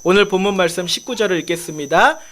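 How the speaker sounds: ordinary talk, nothing marked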